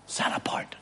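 solemn whisper; whispered emphasis. Acted. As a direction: drop to a whisper to drive it home.

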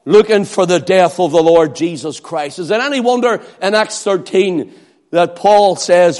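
ordinary talk

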